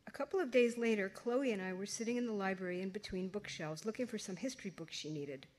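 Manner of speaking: plain reading voice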